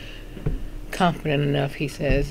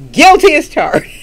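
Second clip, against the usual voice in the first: higher and louder, owning it proudly; a bold happy confession; emphatic admission; 9 semitones higher, 11 dB louder than usual. Real, not acted. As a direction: declare it loud like a proud confession.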